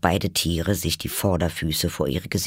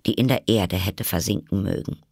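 plain reading voice

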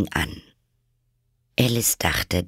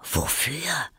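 soft, breath-only query; whispered question; 6 semitones higher, 6 dB quieter than usual. Acted. Alice asks the Rabbit back, whispering.

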